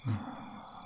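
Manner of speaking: long quiet breathy sigh; soft contented sigh. Acted. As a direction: let out a happy sigh. Content with lover.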